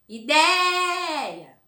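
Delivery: Ideia with DEI held high and loud; stress on DEI. Shown as a demonstration. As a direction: call out Ideia, holding DEI. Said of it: "Penúltima".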